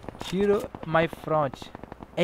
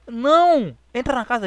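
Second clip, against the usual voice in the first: much higher, louder cry of no; alarmed shout; 8 semitones higher, 9 dB louder than usual. Real, not acted. Shout at danger.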